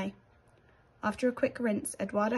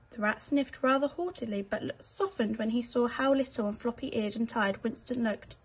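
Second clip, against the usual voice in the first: usual speaking voice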